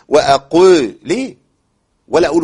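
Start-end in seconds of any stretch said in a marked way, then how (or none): 0.1-0.8 "وأقو" with a very thick, heavy ق, louder; over-heavy qaf. Shown as a demonstration. Teacher showing the over-heavy qaf. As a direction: pump up the ق in "وأقو" until it turns heavy. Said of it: "بقاف مفخمة قوي".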